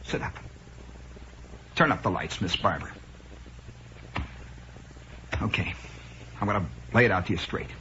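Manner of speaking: low, quiet doctor with long pauses; Casey. Acted. TV doctor about to give the diagnosis, low and grave. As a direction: drop to grave, understated authority. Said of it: "Casey looks at him and says".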